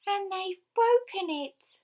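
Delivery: very high, loud, slow wail; tearful Baby Bear. A narrator's reading. Baby Bear finding his chair broken, tearful wail. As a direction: wail it in a squeaky little voice. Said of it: "Baby Bear was in tears".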